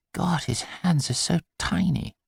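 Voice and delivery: hushed amazed father; tender wonder. Acted. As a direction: marvel quietly at the baby. Father marvels at newborn.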